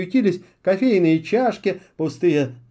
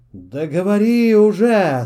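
louder, slow impatient witch; lost temper; 2 semitones higher, 7 dB louder, slower than usual. A narrator's reading. Witch loses patience with her owl, angry. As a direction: demand he finally speak. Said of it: "совсем вышла из себя Аскольда".

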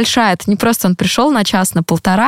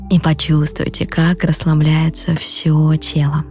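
soft, slow meditation voice; calm guide talking them into rest; guided meditation; 3 semitones lower, 3 dB quieter, slower than usual. Acted. Leads a guided breathing meditation.

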